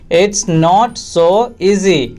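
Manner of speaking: very slow, pause before "easy"; slow repeat. Shown as a demonstration. Teacher repeating for English learners, slowly segmented. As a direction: separate every word for learners.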